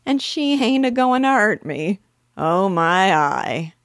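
loud, high-pitched uneducated burglar. A narrator's reading. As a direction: hoot it in amused disbelief, dropping and adding aitches. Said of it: "in his uneducated way".

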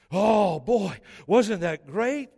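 loud, drawn-out mock delight; mock gushing. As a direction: imitate an overexcited fan, stretching the words. Mimics congregation excited over celebrity.

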